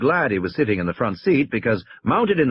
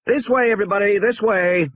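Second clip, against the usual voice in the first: high-pitched call to a group; 10 semitones higher than usual. A narrator's reading. Tour guide herding visitors to the cars, calling out. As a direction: usher everybody along in a raised pitch.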